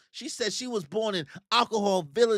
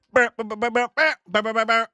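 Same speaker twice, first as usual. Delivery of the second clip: imitated phone buzz; 5 semitones higher, 5 dB louder, faster than usual. Love Island intro phone buzz.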